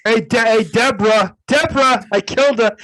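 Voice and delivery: Ray Romano murderer, high and loud; Ray Romano impression. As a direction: whine it like Ray Romano confessing. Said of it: "Who knew you had a Ray Romano in you".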